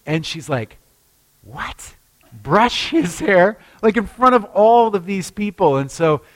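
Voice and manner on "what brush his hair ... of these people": incredulous. Retold or imitated. Her shocked reply retold.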